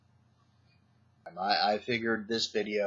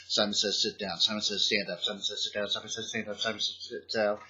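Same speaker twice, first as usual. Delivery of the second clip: rattling the commands off, speeding up; faster and faster; 3 semitones lower, 6 dB quieter, faster than usual. Shown as a demonstration. Teacher speeding up Simon Says to trip the kids, getting faster and faster. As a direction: rush it like an auctioneer. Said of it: "get faster and faster with it".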